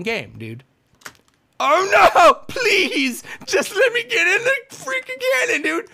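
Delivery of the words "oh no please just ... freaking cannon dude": high, loud half-laughing plea; desperate pleading wail. Real, not acted. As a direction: beg the game, laughing and crying at once.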